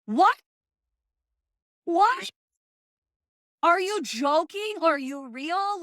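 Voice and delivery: high, loud outraged disbelief; incredulous. Real, not acted. Reacting to the dickhead's remark, loud incredulous disbelief. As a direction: shriek it in total disbelief.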